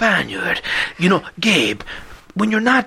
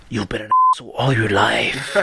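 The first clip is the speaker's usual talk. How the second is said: low ominous villain voice; Spanish Jigsaw voice; 6 semitones lower than usual. Retold or imitated. Jigsaw judging his victim, low ominous voice. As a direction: threaten coldly, low. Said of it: "ominous whisper".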